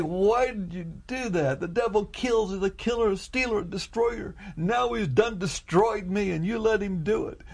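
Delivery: retold wounded whine to God; aching complaint. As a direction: plead and protest, as if betrayed. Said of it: "complaining, aching".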